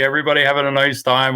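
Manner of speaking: ordinary talk, nothing marked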